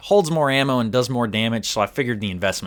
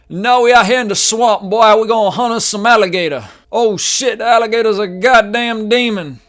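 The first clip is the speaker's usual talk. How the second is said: loud, high Southern yokel; hillbilly voice; 11 semitones higher, 8 dB louder than usual. Pretend. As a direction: holler like a swamp hunter. Hunting alligators in the swamp.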